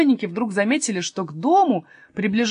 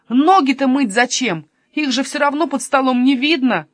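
higher, louder indignant protest; angry shout; 5 semitones higher, 6 dB louder than usual. Acted. Kasper finally losing his temper at foot-washing, angry raised voice. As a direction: cry out in exasperation. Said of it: "крикнул он".

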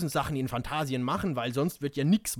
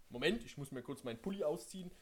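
plain reading voice

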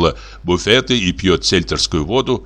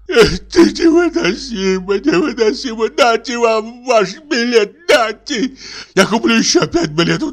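whining invalid; 13 semitones higher than usual. Acted. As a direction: complain like a suffering invalid. Sick passenger complains, high voice. (капризный голос больного)

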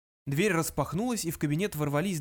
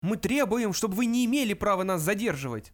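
high, overwrought demand; straining yell; 6 semitones higher, 4 dB louder than usual. A narrator's reading. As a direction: yell it as if straining your lungs. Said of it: "надрывался младший".

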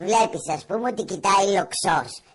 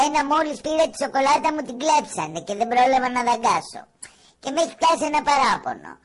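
higher, a lightly voiced pouting kid; mock whiny child; 6 semitones higher than usual. Teasing a girl's sulky photo, a light mock childish whine. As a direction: play the sulky child robbed of her chocolate, half in jest. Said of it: "Ένα τέτοιο είναι".

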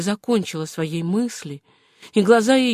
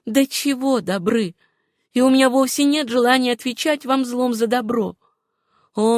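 higher-pitched, earnest woman's voice; Suzanne's voice; 4 semitones higher than usual. Acted. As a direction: refuse him kindly, pitched up. Героиня мягко отказывает Джону. (высокий, искренний голос героини)

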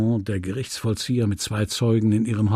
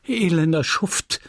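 higher-pitched outraged insult; mildly raised angry outburst; indignant cry; 6 semitones higher than usual. A narrator's reading. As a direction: cry out at a scoundrel. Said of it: "rief sie".

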